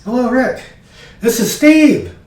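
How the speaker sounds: high, loud caller on a bad line. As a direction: call down the phone to be heard. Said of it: "Then you hear".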